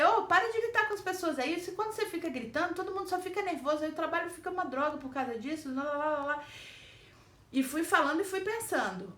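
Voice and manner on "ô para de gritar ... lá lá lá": telling him off. Retold or imitated. New employee scolding the boss for yelling at staff. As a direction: lecture him fast without pausing. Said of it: "E eu falei".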